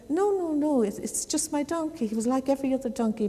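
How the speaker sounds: high, hurried denial; earnest storyteller's acted objection; insistent protest. Nasruddin protests to the visitors.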